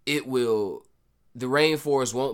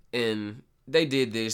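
ordinary talk, nothing marked

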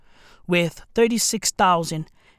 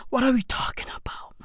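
whisper; 2 semitones higher, 8 dB quieter, faster than usual. Real, not acted. Marvelling aside about LeBron's records.

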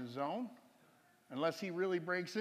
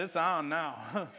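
fired up; 6 dB louder, much slower than usual. Acted. Fired up, declaring a challenge.

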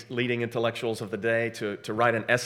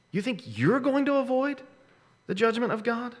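incredulous; 10 semitones higher than usual. Acted. Paraphrase with high incredulous pitch.

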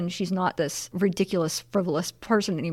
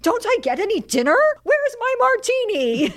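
indignant demand; 14 semitones higher than usual. Retold or imitated. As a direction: play the affronted diner, climbing very high. Abandoned diner demands her dinner.

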